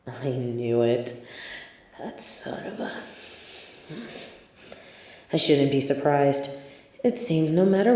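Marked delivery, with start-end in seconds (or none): 0.1-2.9 slow and low, fading into a breathless curse; bitter realization trailing into a whisper; seething whisper. Acted. Villain realizing his nemesis fooled him, slow seething whisper.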